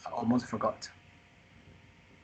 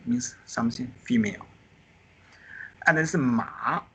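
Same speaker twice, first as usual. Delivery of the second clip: ordinary talk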